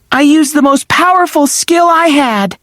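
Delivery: usual speaking voice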